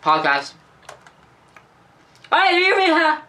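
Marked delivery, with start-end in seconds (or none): 2.3-3.2 high, loud call. Real, not acted. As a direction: call across to him. Calling out to friend.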